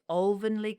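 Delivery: "Alvanley" announced as OL-vun-lee; local Alvanley pronunciation. Shown as a demonstration. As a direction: give "Alvanley" a rounded OL for the members. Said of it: "this is the pronunciation".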